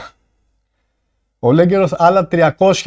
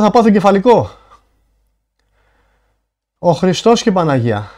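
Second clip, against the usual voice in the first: stunned disbelief; 3 semitones higher than usual. Real, not acted. Streamer asking viewers to confirm the donation, incredulous. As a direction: reel from the shock, appealing to your viewers.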